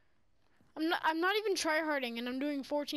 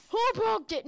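frustrated shout; 8 semitones higher, 7 dB louder than usual. Real, not acted. Frustrated outburst in game.

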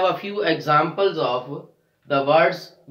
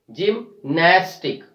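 "Gymnastic" slowed, split before a strong NAS; stress on NAS; slower than usual. Shown as a demonstration. Teacher modelling second last syllable stress, slow "gymNAStic". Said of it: "We are stressing on the second last syllable".

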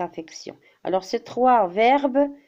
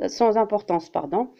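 usual speaking voice

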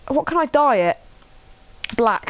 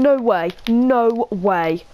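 ordinary talk

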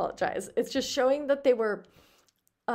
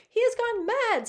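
much higher, mildly alarmed reading; alarmed exclamation; 12 semitones higher, 5 dB louder than usual. A narrator's reading. Minnie watching the bacteriologist run off, alarmed exclamation. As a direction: exclaim it in high-pitched dismay. Wife alarmed at husband's behaviour.

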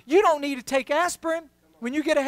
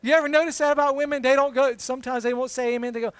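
usual speaking voice

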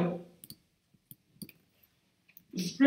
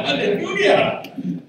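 laughing hallelujah, high and loud; joyful laughing hallelujah; laughing praise; 10 semitones higher, 7 dB louder, slower than usual. Real, not acted. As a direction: laugh with joy as you praise.

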